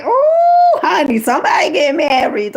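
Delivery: very high, loud squeal; excited ooh. Real, not acted. Thrilled at the marriage news, a loud squeal. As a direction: shriek with delight.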